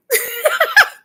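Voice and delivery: loud, high-pitched laugh. Real, not acted. Laughing at her own phone-call act, high laugh.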